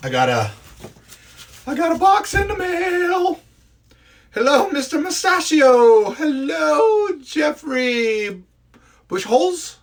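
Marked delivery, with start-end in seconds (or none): 1.7-3.4 high, excited sing-song announcement; excited squeal. Real, not acted. Excited host telling live viewers about mail. 4.3-9.7 excited shout. Real, not acted. Greeting viewer by name.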